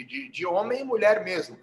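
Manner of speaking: usual speaking voice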